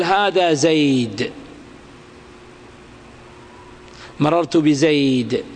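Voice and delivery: زيد said slowly and ended on a silent د, no case vowel; وقف بالسكون على زيد; pausal sukun. Shown as a demonstration. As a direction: clip زيد dead on the د. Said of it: "فتسكن".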